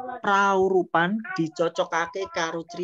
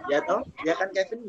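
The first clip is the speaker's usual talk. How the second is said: ordinary talk, nothing marked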